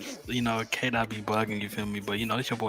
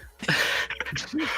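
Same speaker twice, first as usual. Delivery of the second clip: laughing, high and voiceless; high breathy laugh. Real, not acted. Storyteller cracks up after his joke, high airy laugh.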